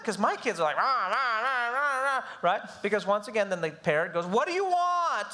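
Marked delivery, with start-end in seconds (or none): 0.7-2.2 mock whiny rah rah; playful nagging babble; kids' nagging noise. Imitating demanding kids. 4.2-5.3 high, slow exasperated what do you want; mock annoyed parent; impatient parent. Parent yelling at kid.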